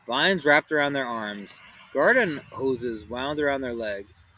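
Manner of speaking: ordinary delivery, nothing marked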